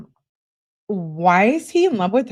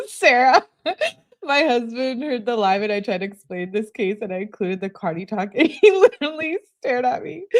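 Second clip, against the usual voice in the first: high-pitched, talking through real laughter; laughing; 5 semitones higher than usual. Real, not acted. Telling Sarah about her husband staring, laughing. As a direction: crack up while telling the story. Cracking up at listener's comment.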